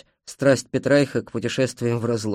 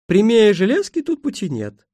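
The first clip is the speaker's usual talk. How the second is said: high woman's voice with a smirk; amused station mistress; 9 semitones higher, 4 dB louder than usual. A narrator's reading. Woman answers the hopeful traveller, high and smirking. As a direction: answer as a wryly amused countrywoman, pitched up. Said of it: "усмехнулась женщина".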